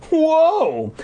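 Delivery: startled exclamation; surprised whoa. A narrator's reading. Brother surprised.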